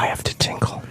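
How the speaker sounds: whispered aside, voice dropped away. Real, not acted. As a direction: murmur it under your breath, off mic. Quiet aside during radio show.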